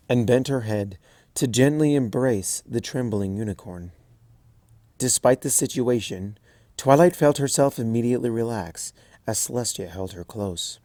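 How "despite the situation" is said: usual speaking voice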